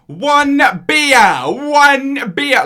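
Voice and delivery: very loud, high, over-enunciated mock shout; condescending tourist shout. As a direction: imitate a tourist talking down to non-English speakers. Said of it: "They're being condescending".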